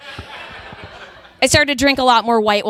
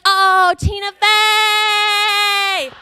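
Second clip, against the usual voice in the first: high, long, sung-out name; hammed-up dad's sudden delight; delighted recognition; 10 semitones higher, much slower than usual. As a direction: sing it out as it finally clicks. Dad finally recognizes the name.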